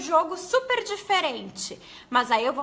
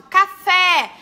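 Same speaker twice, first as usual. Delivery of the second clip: Café called out loud, stress on FÉ; teacher shouting a word to find its stress; shouted word; 4 semitones higher, 11 dB louder than usual. Shown as a demonstration. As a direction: call Café like shouting across a room. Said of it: "falo uma palavra alto?".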